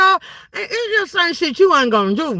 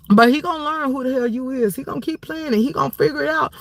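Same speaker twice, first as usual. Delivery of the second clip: usual speaking voice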